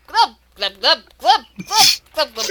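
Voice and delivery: glub glub, bubbling mock speech; talking underwater. Shown as a demonstration. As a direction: gurgle the words as if submerged. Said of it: "your ability to talk underwater is severely compromised".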